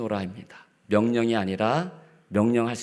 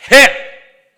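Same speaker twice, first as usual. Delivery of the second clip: sudden shouted order, much higher and louder; barked command; 13 semitones higher, 18 dB louder than usual. Shown as a demonstration. Commanding someone from a position of authority, sharp shout. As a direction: snap the order like a harsh boss. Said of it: "이게 아니고".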